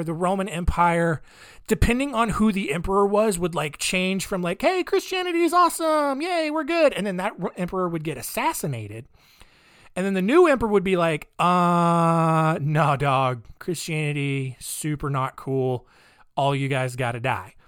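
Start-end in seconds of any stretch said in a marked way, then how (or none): 4.6-6.9 high-pitched, gleeful cheering; hammed-up chirpy enthusiasm; mock cheerful. 11.4-17.5 low, drawn-out laid-back refusal; hammed-up deadpan disapproval; mock dismissive. Acting out the hostile new emperor.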